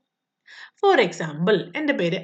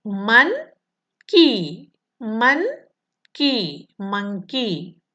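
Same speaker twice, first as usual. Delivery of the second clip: മൻ and കി said apart, then monkey joined; monkey split into syllables, then said whole; much slower than usual. Shown as a demonstration. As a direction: tap out monkey in two beats for children. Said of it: "വേർഡിനെ നമ്മൾ different syllable ആയിട്ട്".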